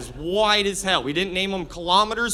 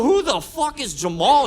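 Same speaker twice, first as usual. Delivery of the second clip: loud, higher, indignant question; acted-out jealous outburst; indignant demand; 5 semitones higher, 5 dB louder than usual. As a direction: demand an answer in mock outrage. Retelling his outburst at wife.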